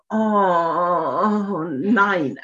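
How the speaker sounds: drawn-out dismayed groan, half in fun; hammed-up moan of dismay; mock wail. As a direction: let out a long, playful lament. Acts out her wailing thought.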